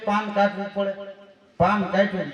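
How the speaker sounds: ordinary talk, nothing marked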